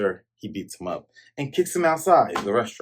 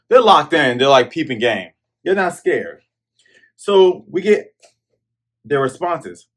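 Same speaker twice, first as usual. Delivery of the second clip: ordinary talk, nothing marked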